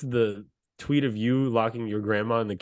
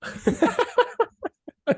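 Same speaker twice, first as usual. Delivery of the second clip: burst of laughter. Real, not acted. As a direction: laugh out hard at him. Laughing at the rival fan.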